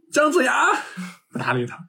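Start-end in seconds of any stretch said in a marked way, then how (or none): 0.1-0.7 calling out from behind. Retold or imitated. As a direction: call after him to make him turn around. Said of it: "然后喊". (高声远远地喊)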